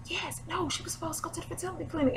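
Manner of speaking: high, soft, rushed stage whisper; loud excited whisper. Retold or imitated. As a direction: imitate a clerk whispering urgently to a coworker. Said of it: "She's whispering really, really loud".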